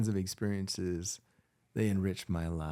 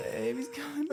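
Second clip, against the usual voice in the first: very high, breathy moaning labour voice; woman in labour. Retold or imitated. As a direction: moan it like a mother mid-contraction. Repeats labour imitation.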